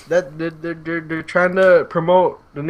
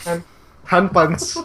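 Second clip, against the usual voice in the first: put-on Filipino accent; 4 semitones higher than usual. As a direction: hand puns in a Filipino accent. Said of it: "Say in a Filipino accent".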